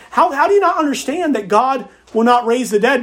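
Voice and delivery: ordinary talk, nothing marked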